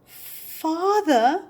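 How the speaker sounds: long, high drawn-out "Father?"; slow, wary question. Acted. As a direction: stretch the word out in dawning dread. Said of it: "said Basil slowly".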